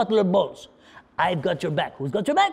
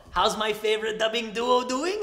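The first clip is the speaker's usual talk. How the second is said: ordinary delivery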